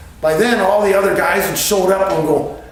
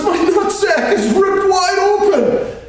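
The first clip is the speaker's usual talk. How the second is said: very high, loud mock wail; panicked yell; 5 dB louder than usual. Friend announcing his torn injury, high loud mock wail. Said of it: "He goes".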